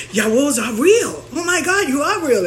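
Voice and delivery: high, thrilled squeal of disbelief; imitating awestruck fans; starstruck excitement. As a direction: gush like meeting Santa Claus. Sisters amazed at meeting a Yawó.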